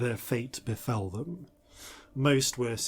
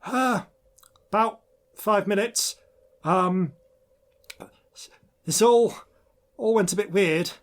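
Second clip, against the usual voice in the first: maintenance woman voice; 4 semitones higher than usual. Acted. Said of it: "is Tully Scourer, uh, the human woman".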